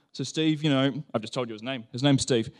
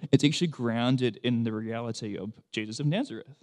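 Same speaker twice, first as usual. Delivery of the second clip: ordinary delivery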